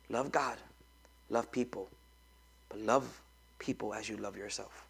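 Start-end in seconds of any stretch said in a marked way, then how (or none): none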